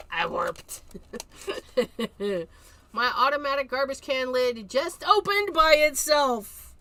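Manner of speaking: ordinary delivery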